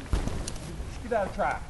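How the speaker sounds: normal talking voice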